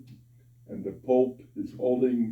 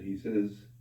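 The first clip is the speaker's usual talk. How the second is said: ordinary talk, nothing marked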